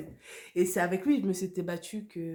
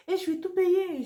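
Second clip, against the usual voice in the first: much higher and louder, outraged; indignant outburst; 11 semitones higher, 7 dB louder than usual. Real, not acted. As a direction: hammer home the unfairness. Indignant about paying for everything.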